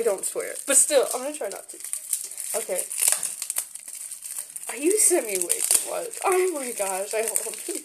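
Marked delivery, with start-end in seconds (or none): none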